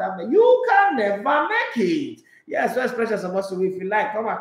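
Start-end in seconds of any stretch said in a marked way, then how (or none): 0.0-2.2 slow, higher, scornful "never"; dismissive verdict. Retold or imitated. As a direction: draw out the put-down. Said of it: "I said, "Oh, Lord".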